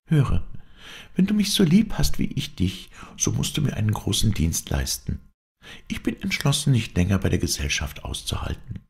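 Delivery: put-on high-pitched, pleading girlish voice; earnest young woman confiding. Heroine asking her lover for a great service, earnest.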